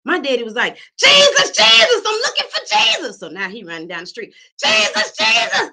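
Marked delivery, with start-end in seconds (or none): 1.0-3.2 high, loud calling for Jesus; excited shouting dad. Retold or imitated. 4.6-5.7 high, loud calling for Jesus; excited shouting dad. Retold or imitated. Dad shouting while running.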